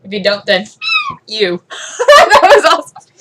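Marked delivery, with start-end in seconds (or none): 1.3-3.1 high, loud, slow laughing. Real, not acted. Vlogger laughing at her own joke. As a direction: laugh it out delighted.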